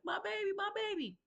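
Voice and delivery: high, frantic replay of her own cry for help; panicked cry. Retold or imitated. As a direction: cry it out like a mother in panic on the phone. Retelling her panicked call to police.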